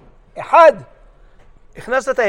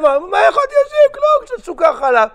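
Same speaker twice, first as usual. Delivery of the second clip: high mock woman's voice; 11 semitones higher, 4 dB louder than usual. Rabbi's wife explains.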